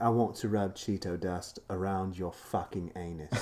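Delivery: put-on British accent, measured and controlled. Imagining an actress making a lewd offer, British accent. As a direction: imitate an English actress's accent. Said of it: "that British accent".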